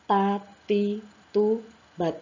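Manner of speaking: ta, ti, tu, bat as separate, measured syllables; pronunciation drill. Shown as a demonstration. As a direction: model "Ta, ti, tu, bat" for learners, tongue tip on upper gum. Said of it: "Nah, seperti itu".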